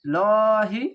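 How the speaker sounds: tajweed heavy letter; heavy thick lam. Shown as a demonstration. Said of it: "মোটা করে পড়বেন".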